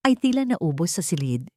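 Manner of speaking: ordinary talk, nothing marked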